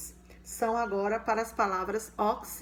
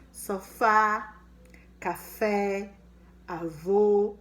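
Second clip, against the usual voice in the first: Sofá, café, avô said louder, each one apart; example words said out loud; 6 dB louder, much slower than usual. Shown as a demonstration. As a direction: hit each example word. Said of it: "Aí eu tenho os exemplos".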